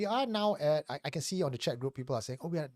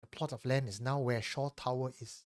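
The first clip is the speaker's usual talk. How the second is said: story narration; 3 semitones higher than usual. Acted. Reads a listener's confession.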